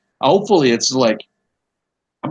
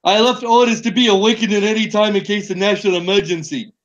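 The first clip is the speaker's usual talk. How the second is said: higher, bad Donald Trump impersonation; Trump impression; 6 semitones higher than usual. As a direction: read it in a hammed-up Trump voice. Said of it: "distracted by the accent".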